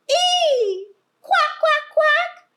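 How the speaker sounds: very high, loud squeal and quacks; shrill animal cries. Acted. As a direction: squeal then quack like the farm birds. Pig and duck voiced in story.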